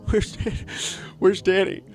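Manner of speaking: tiny high-pitched frightened whimper; mock scared. Host pretending to miss his absent partner, mock scared. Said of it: "I'm scared. I'm trembling".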